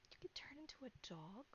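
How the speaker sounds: hushed, stunned whisper. Acted. Character whispering to her friend, stunned he becomes a dog. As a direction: breathe it out in disbelief.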